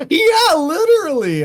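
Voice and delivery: high, loud burst through real laughter; laughing agreement. Real, not acted. Co-host cracks up at the friend's mocking line, laughing agreement.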